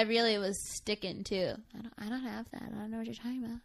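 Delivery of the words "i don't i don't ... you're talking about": retold hushed denial; soft denial. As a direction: softly deny all knowledge. Retold feigned ignorance in high school.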